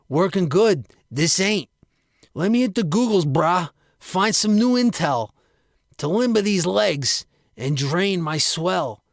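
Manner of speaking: a pumped-up bro griping about his body; Frank's bro voice. Acted. Frank to his pal, griping in a brash bro voice.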